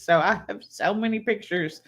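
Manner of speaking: ordinary talk, nothing marked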